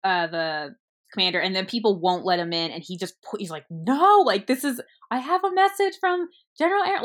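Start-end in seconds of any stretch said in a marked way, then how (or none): 3.7-7.0 high-pitched, urgent acted plea; insistent protest. Messenger insisting he has a message from the general, urgent protest. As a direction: play a messenger pushing back, voice jumping up.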